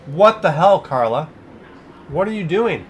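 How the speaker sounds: surprised shout. Real, not acted. Donor surprises streamer.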